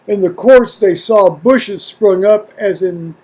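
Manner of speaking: measured, solemn reading of scripture. Acted. Reading First Maccabees to his viewers, solemn reading voice. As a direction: read it out like an old account, steady and deliberate.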